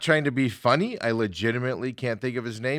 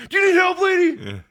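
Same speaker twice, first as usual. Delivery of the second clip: much higher, louder yelled question; panicked shout; 6 dB louder than usual. Retold or imitated. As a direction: yell it like you're freaking out. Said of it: "but I was probably like".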